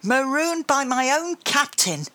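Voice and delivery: high indignant shout as Grandma. A narrator's reading. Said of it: "Grandma shouted".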